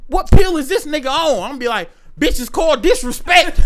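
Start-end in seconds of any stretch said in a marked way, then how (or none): 0.1-1.4 loud, raised-pitch disbelief; baffled onlooker. Acted. Voices baffled club onlookers. 2.2-3.6 loud, high, hammed-up indignation; mock outrage. Fighter answering onlookers in club, hammed-up outrage.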